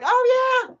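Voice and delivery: high, breathy gushing lefty voice; mock liberal friends cooing over drag queens. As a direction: parody cheerful liberal approval. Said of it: "all my lefty liberal friends were like".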